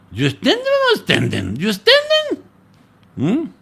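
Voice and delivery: teasing question jumping very high; preacher teasing the congregation whether they get it, in a very high voice; playful check. Real, not acted. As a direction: tease the crowd with a squeaky question.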